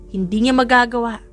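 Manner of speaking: ordinary delivery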